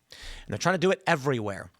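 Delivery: normal talking voice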